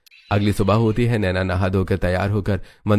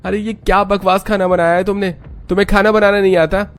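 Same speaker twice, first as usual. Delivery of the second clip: much higher and louder, contemptuous yelling; angry outburst; 10 semitones higher, 3 dB louder than usual. Acted. Husband yells at wife over the food, furious. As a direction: yell in disgust at the food. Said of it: "इतना कहते ही रोहन गुस्से में".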